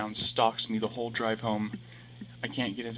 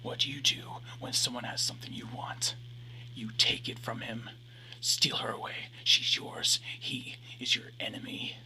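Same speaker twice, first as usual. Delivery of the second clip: unvoiced ghostly whisper; eerie whisper. A narrator's reading. Reflection whispers to him to win her back. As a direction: breathe it like a voice inside his head.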